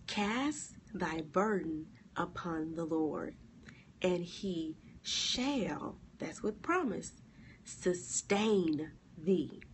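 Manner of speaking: slow, drawn-out reverent reading; solemn slow scripture reading. Acted. Preacher reads the promise slowly to her viewers. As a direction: read it slowly, weighing each word.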